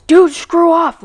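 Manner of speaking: high, loud mock yell; imitated yelling. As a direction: mimic a girl yelling at you to back off. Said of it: "yelling at me".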